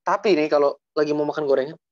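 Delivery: usual speaking voice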